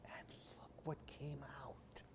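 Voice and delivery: breathy suspense whisper to the listeners. A narrator's reading. Storyteller builds suspense for the children, whispered. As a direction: hush them before the reveal.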